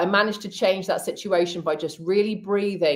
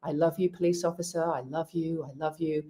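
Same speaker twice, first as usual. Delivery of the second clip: silent mantra; 7 dB quieter than usual. Retold or imitated. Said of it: "in my mind, I'm going".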